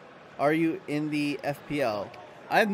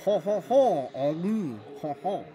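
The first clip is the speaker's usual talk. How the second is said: lightly hammed French caricature laugh; a hint of caricature French; mock French accent; 3 semitones higher, slower than usual. Shown as a demonstration. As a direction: toss off a cartoon French "ho ho". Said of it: "if I were to do a French accent".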